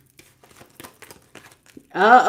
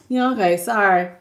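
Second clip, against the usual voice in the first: quieter, lower, tossed-off apology; flippant sorry; 5 semitones lower, 5 dB quieter than usual. Retold or imitated. Ex brushing off the hurt, quiet flippant sorry. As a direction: shrug the apology off like it's nothing. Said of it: "just a little flippant sorry".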